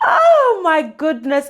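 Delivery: loud, high and slow gush; overjoyed. Real, not acted. Vlogger overjoyed to be back on screens, loud. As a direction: gush with real joy.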